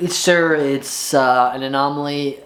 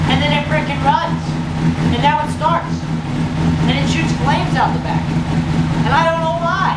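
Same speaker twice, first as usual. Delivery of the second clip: much higher, squeaky with disbelief; 13 semitones higher than usual. Real, not acted. Owner baffled that his motorcycle suddenly runs, squeaking with disbelief. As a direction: squeal it out like you can't believe it. Incredulous about bike suddenly running.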